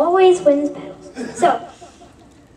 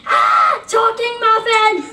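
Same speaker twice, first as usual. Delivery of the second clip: high, loud, slow alarmed shout; startled shriek; 8 semitones higher, 5 dB louder, slower than usual. Acted. Muffin startled by a talking muffin, high loud shout. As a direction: shriek in fright.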